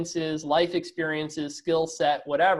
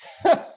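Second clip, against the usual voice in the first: a quick burst of real laughter; short laugh; 9 dB louder than usual. Real, not acted. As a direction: laugh out loud at the answer.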